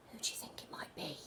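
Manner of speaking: fast hushed whisper. Acted. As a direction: breathe it out secretly. Mysterious figure speaking in the woods.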